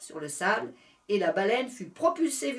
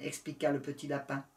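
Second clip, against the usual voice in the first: ordinary delivery, nothing marked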